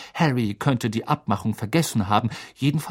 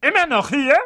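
high, loud bark at him; 14 semitones higher, 4 dB louder than usual. Acted. Aunt barking at Harry in disdain. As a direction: sneer it loud. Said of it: "bellte sie".

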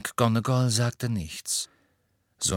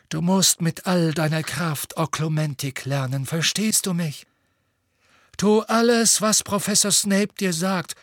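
higher-pitched, insistent instruction; emphatic urging; 8 semitones higher than usual. A narrator's reading. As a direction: press it on the pupil with real urgency. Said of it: "sagte er nachdrücklich".

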